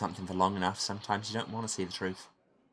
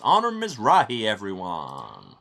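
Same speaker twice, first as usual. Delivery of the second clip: showman's introduction; 9 dB louder, slower than usual. Acted. Emcee presenting a famous detective to the crowd, showman's introduction.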